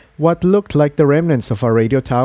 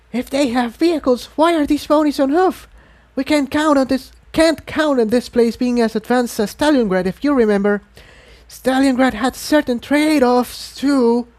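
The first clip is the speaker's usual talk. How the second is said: hissed; 13 semitones higher than usual. A narrator's reading. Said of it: "Tiptoe hissed".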